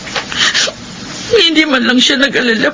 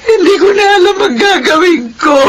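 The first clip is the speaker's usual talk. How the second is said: despairing cry; 7 semitones higher than usual. Acted. Mother despairing over daughter.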